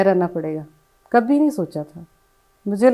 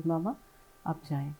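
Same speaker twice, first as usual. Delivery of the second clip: soft slow voice; 2 semitones lower, 12 dB quieter, faster than usual. Retold or imitated. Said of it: "سلو سی آواز آئی، آہستہ سی آواز".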